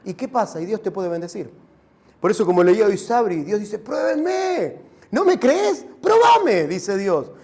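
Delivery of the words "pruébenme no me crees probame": high-pitched, emphatic challenge. Acted. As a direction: voice God daring the listener to test him. Voices God challenging the believer.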